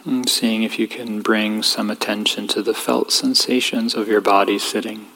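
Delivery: calm, even voice with long pauses; guided meditation. Real, not acted. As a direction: gently guide them inward, leaving space between phrases.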